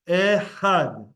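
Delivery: "Ehad" with a throaty het, firm and drawn out; het sound demo. Shown as a demonstration. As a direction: stress "Ehad" on the second syllable, het from the throat. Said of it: "That's the het sound".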